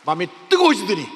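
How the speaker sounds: loud, high surge of preaching fervour; fervent outburst. Real, not acted. Preacher recounting receiving the Spirit, fervent and loud. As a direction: burst out loudly at the climax.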